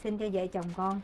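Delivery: soft, low prayer voice; praying. Acted. Wife praying to the Kitchen God for her household, softly praying. Prays at the altar.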